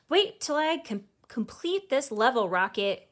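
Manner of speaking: mildly grumpy, eyes still on the game; grumbling. A narrator's reading. Brother grumbles at sister to wait for his level, mild grumble. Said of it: "he grumbles".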